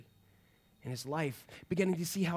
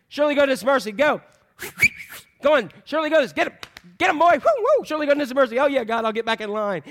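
high, loud, excited call to herding dogs; 9 semitones higher, 10 dB louder, faster than usual. Acted. God calling his herding dogs, high and loud, sending them out. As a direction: urge the dogs on like a shepherd yelling.

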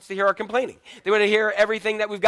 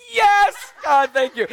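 much higher and louder whoop; mock joyful praise; 7 semitones higher, 7 dB louder, much slower than usual. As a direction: cry out thanks in hammed-up glee. Parents relieved phone lost.

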